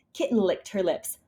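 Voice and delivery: plain reading voice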